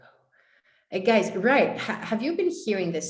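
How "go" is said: soft urging whisper; whispered inner voice. Retold or imitated. Inner voice urging her to go, whispered. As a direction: breathe go like a voice inside. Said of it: "something inside was like".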